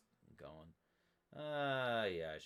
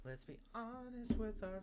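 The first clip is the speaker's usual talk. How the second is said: mock high singsong. Mocking phrase.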